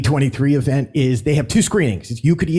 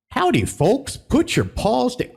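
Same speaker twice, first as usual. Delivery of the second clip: high, slow Southern bear host voice; lightly put-on Southern accent; Country Bear drawl; 9 semitones higher than usual. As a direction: ham up a folksy Southern welcome. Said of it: "I'm not even gonna do the accent".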